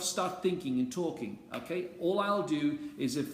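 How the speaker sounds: normal talking voice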